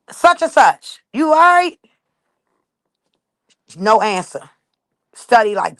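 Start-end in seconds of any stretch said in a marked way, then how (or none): 0.1-1.8 much higher and louder, urgent call; calling out worried. Retold or imitated. Calling to her sister in the dark cell, high urgent call.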